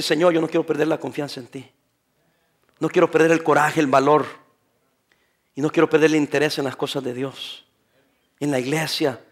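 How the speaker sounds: soft, low, hushed prayer to God; quiet prayer. Acted. Pastor praying aloud to the Lord, hushed and low. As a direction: pray it gently under the breath.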